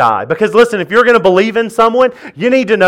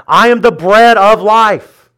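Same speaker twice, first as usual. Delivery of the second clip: preaching proclamation. Acted. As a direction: proclaim it like scripture. Proclaiming Jesus' words.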